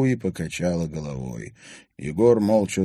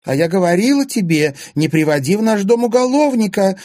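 high-pitched, loud angry screech; shrieking; 13 semitones higher, 8 dB louder than usual. A narrator's reading. Anfisa berating her husband over the houseguest, shrieking. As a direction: shriek it as a furious accusation. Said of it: "визжала Анфиса Зверева".

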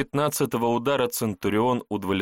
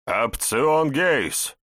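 commanding call; 6 semitones higher, 4 dB louder, much slower than usual. Acted. Centurion summons officer.

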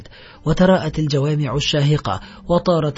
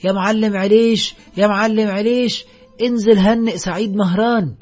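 raised, higher-pitched call to an upper floor; 6 semitones higher, 4 dB louder than usual. A narrator's reading. A man summoning the boss from the second floor, shouting up. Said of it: "وصاح أحد الرجال موجها حنجرته".

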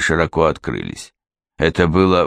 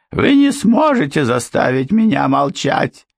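housekeeper, high and desperate; desperate objection; 9 semitones higher, 3 dB louder than usual. A narrator's reading. Housekeeper objecting in despair to Miss Trevick. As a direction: protest in desperation, pitched up. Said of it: "с отчаянием возразила та".

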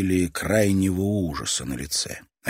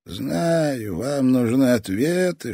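put-on high, slow, sinister old toymaker; a menacing old man savouring every word; creepy old man voice; 6 semitones higher, much slower than usual. Old toy master fading.

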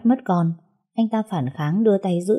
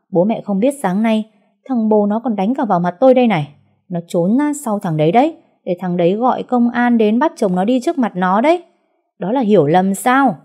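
higher, louder furious accusation; angry roaring; 4 semitones higher, 5 dB louder than usual. Acted. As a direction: keep roaring at the in-laws. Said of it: "gầm lên như một con thú hoang".

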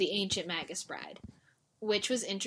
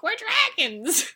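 mock baby dragons; 12 semitones higher, 5 dB louder than usual. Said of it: "annoying baby dragons who are like".

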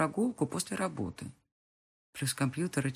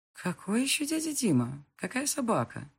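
surprised; 7 semitones higher than usual. A narrator's reading. Mother puzzled.

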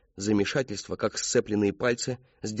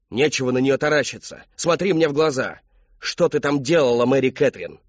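loud, raised angry demand; furious father; 5 semitones higher, 7 dB louder than usual. A narrator's reading. Father confronting his daughter, loud and furious. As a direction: order her to face you, loudly. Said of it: "Никогда ещё она не видела такой ярости".

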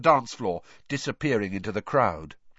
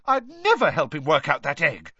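higher, louder indignant denial; outraged; 6 semitones higher, 5 dB louder than usual. A narrator's reading. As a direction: deny it as if insulted. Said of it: "looking outraged".

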